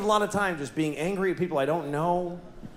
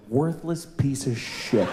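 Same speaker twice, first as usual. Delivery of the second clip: muttered insult; 6 semitones lower, slower than usual. Retold or imitated. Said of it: "this came out of my mouth".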